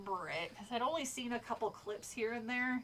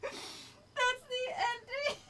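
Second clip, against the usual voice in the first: high, drawn-out cracking-up disbelief; real helpless laughing outcry; incredulous laughing; 14 semitones higher, much slower than usual. Real, not acted. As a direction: squeal it slowly through laughter.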